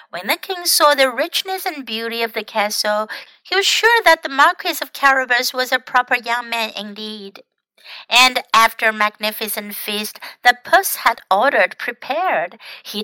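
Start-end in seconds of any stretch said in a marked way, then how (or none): none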